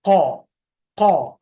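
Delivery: showing students the back-of-throat क; deep throaty q. Shown as a demonstration. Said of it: "अंदर की तरफ लीजिए".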